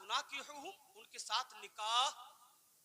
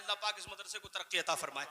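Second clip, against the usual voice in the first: ordinary talk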